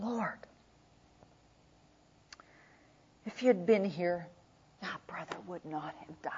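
low, very soft, breaking into a whisper; grief-stricken, barely voiced; grieving whisper. Mary at Jesus' feet, soft grieving whisper.

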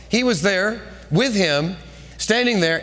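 ordinary delivery

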